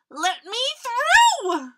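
high, loud, stretched-out demand; urgent squeal. A narrator's reading. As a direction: cry out to get past, desperate. Crocodile urgently pushes past.

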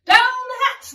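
high, loud crew echo; shouting. Acted. Crew echoes captain at the dinner table, shouting. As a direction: shout it back as one rowdy crew. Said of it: "we all shouted".

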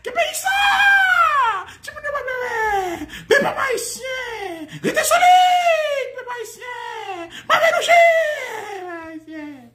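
very high, drawn-out crying shouts; hammed-up falsetto lament, each phrase stretched; mimicked tearful wailing. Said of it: "la vini dit". (imitasyon kriye ak gwo rèl)

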